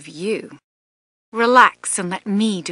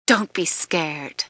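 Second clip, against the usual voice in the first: normal talking voice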